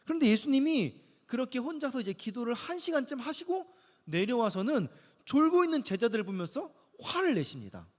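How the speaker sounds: ordinary delivery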